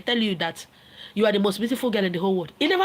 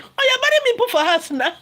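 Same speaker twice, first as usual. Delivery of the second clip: much higher and louder mock outrage; mock indignant; 11 semitones higher, 5 dB louder than usual. A woman accusing the man of marrying her for a house, loud mock outrage. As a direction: shriek it like an offended lover.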